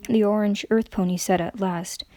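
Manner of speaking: usual speaking voice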